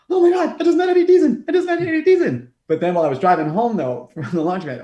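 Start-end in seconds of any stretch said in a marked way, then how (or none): 0.1-2.5 high, loud, laughing squeal of delight; starstruck excitement. Retold or imitated. Fan retelling calls to friends, squealing with excitement. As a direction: gush like a thrilled fan. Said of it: "I called, like, everyone that I knew, and I was like".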